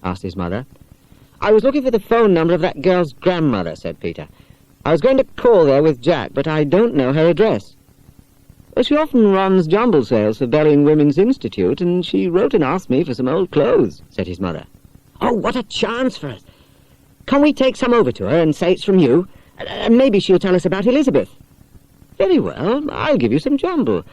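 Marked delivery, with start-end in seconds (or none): 1.4-3.7 lightly voiced eager boy, higher; a boy explaining to his mother; Peter. 4.8-7.8 lightly voiced eager boy, higher; a boy explaining to his mother; Peter. Peter continues. 8.7-14.0 a hint of a kind mother, higher; mother chatting helpfully to her son; mother's voice. Mother explains. 15.2-21.3 lightly voiced excited boy, higher; a boy jumping at a chance; eager boy.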